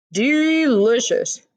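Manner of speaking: slow, relishing praise; savoring. Acted. Mister Lane tasting the tart, slow and relishing. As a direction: draw it out, savoring.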